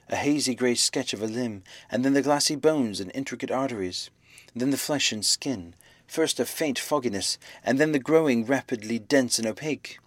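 ordinary delivery, nothing marked

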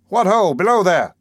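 loud, high call down below; shout. A narrator's reading. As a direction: call down the hatch loudly. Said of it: "I heard Latimer shout down".

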